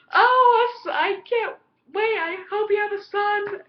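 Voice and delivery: excited bad English accent voicing Catherine. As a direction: camp up a posh English queen mother in an English accent. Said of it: "my failure attempt at an English accent".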